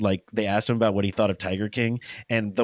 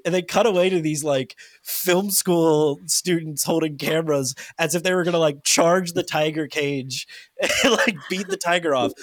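usual speaking voice

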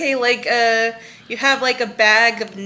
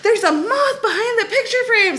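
put-on scared child; 10 semitones higher than usual. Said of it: "Brody's like".

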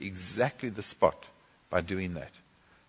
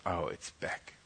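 soft, dismayed report; quiet dismay; 3 semitones lower, 13 dB quieter than usual. Retold or imitated. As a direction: say it low and defeated. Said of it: "She says".

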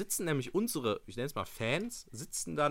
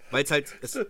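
usual speaking voice

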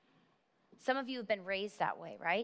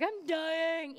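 hammed-up wail with raised pitch; melodramatic; 6 semitones higher than usual. Preacher mocking their own dramatics, melodramatic. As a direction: play up the drama, pitched high. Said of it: "I'm melodramatic myself".

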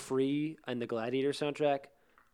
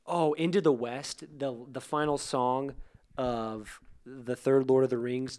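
usual speaking voice

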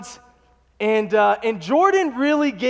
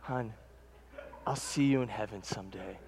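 soft, low dying whisper of farewell; 12 semitones lower, 14 dB quieter than usual. Retold or imitated. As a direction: fade out like a man breathing his last to his wife. Dying man's last words to wife.

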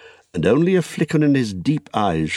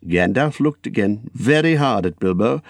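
plain reading voice

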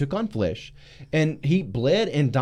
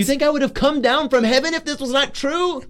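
much higher, louder incredulous rebuke; indignant Gabriel; 12 semitones higher, 4 dB louder than usual. Acted. Gabriel scolding Zechariah's disbelief, high indignant rebuke. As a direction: demand it like an angel offended. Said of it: "you got louder and slower".

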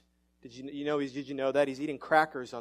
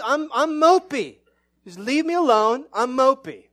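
higher, louder mock sulk with laughs; self-mocking grumpy protest, higher and louder; hammed-up mopey whine; 6 semitones higher, 10 dB louder than usual. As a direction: play the sulking man who wants to be left alone. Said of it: "if it doesn't happen outwardly".

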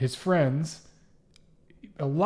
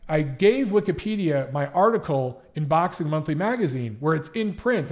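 ordinary talk, nothing marked

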